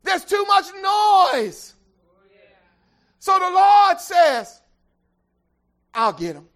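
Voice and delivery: loud, high whooping sermon climb; preaching at full force. Real, not acted. Preacher whooping to the congregation, loud and high. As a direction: build the sermon to a roaring peak.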